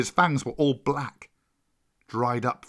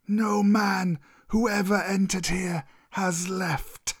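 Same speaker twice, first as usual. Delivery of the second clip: slow, higher-pitched serpent voice; menacing old cobra; 6 semitones higher, slower than usual. Acted. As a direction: warn him in long-drawn words. Cobra threatens Mowgli.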